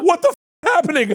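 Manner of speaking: high, fast retold panic; freaked out. Comic confronted backstage by angry stars, retold as freaked out. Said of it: "I was so freaked out".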